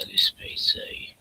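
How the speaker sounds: slow, whispered "this P C"; whispering. Real, not acted. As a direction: whisper it slowly to yourself. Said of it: "Here's this P C".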